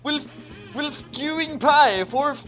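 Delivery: usual speaking voice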